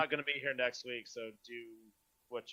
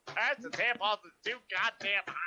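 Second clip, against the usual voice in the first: ranting announcer; 6 semitones higher than usual. Pretend. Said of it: "I love the way that that was presented".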